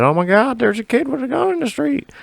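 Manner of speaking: very high falsetto squeal; squeaky, hammed-up alarm from timid old folks; mock panicked old ladies. Imitating old ladies calling the cops, mock panic.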